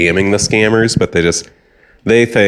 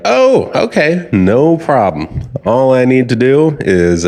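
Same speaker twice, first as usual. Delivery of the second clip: lightly played, breezy phone scammer, pitched up; accommodating scammer; 6 semitones higher than usual. Scammer offers to fix the old caller's bill, accommodating. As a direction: start high, then stretch out the reassurance.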